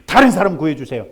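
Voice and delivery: loud, fast, self-assured brush-off; confident refusal. Acted. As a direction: wave off the rescue smugly. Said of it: "그래".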